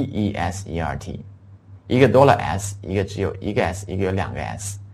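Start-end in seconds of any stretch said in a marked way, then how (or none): none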